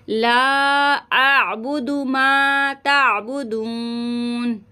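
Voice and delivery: ला stretched long in slow, melodious recitation. Shown as a demonstration. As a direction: draw out ला, then recite slowly. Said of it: "पहले लाम को खींचेंगे". (धीमी तिलावत, ला लंबा)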